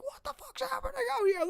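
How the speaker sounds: very high shrill mom yell; a panicked mother's mock shriek. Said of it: "yelling, screaming".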